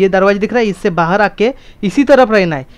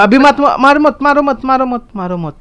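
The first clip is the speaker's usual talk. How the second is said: higher, rapid-fire repeated plea to stop; urgent warning; 7 semitones higher, 4 dB louder than usual. Real, not acted. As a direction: call out to stop a teammate at once. Urgently stopping a teammate from attacking.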